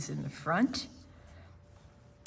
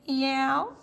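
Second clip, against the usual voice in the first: light higher meow; cat meow; 6 semitones higher, 5 dB louder than usual. Acted. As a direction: mew like a cat interrupting. Reading a cat story aloud.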